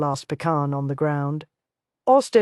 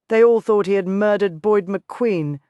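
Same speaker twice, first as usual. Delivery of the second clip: ordinary delivery, nothing marked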